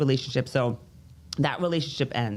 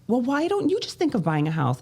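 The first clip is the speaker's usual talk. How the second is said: high mock-parent voice, pushing the idea; lightly voiced mother, much higher; mocking mom; 9 semitones higher than usual. Parents urging her to buy a house, high mock voice. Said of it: "my parents are like".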